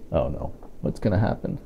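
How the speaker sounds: ordinary delivery, nothing marked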